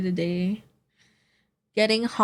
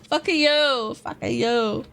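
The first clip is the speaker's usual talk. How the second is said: laughing shout; 6 semitones higher, slower than usual. Real, not acted. Repeated playful curses at Zara.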